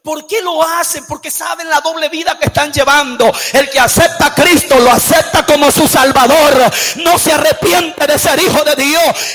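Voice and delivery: emphatic sermon, ringing phrase by phrase; full-force preaching. Real, not acted. Preacher exhorting church to live as true Christians, full-force preaching. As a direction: drive each phrase home to the congregation.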